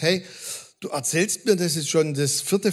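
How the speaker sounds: ordinary talk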